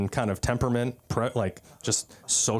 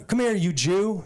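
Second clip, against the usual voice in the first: higher-pitched imitation of a street-court taunt; mock taunt; 7 semitones higher than usual. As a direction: call him over with mock aggression. Imitates taunting basketball player.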